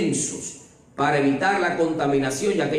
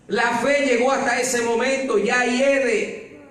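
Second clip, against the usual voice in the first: higher, louder, slow fervent preaching; preaching at full force; 11 semitones higher, 4 dB louder, slower than usual. Acted. Preacher urging the church that God's voice restores life, fervent preaching. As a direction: drive the point home to the congregation. Sermon climax to congregation.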